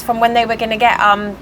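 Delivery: usual speaking voice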